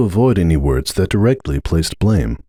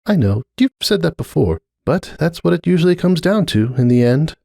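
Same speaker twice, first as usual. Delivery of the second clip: put-on higher, uneasy young mare's voice; Cupcake, uneasy mare; 9 semitones higher than usual. Cupcake telling Starlight she feels the rift, higher uneasy voice. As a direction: gently admit discomfort, voice lifted.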